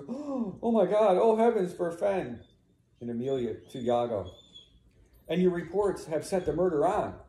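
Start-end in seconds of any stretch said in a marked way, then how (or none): 0.1-2.4 high, loud mock horror after a gasp; feigned shock. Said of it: "gonna act surprised". 5.3-7.2 raised pitch, pointed accusation; accusing declamation. Acted. Read line, then plainly explained.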